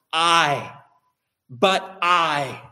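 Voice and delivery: "I" set apart and hit hard; emphatic I. Shown as a demonstration. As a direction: lean firmly on each "I", pausing between. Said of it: "The I is emphatic".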